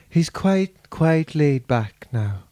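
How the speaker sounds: lightly attempted Donegal accent, slow. Shown as a demonstration. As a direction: try a slow, laid-back Donegal accent. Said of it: "what [name] sounds like".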